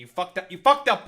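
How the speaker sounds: loud, frustrated yell at the chatter; exasperated shout. Real, not acted. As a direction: yell it at him, fed up. Scolding the chatter for the bit.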